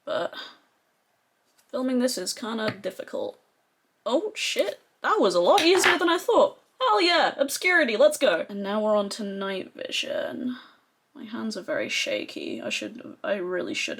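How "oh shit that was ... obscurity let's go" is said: high, loud burst of real delight; thrilled. Real, not acted. Beauty vlogger thrilled the eyeshadow popped out so easily, excited cheer. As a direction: cheer like you just won.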